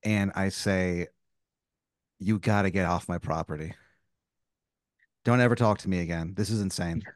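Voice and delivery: low, quiet, controlled indignation; stern refusal. Acted. Homeowner refusing the neighbor's vet bill, stern and low. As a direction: warn them off, low and measured.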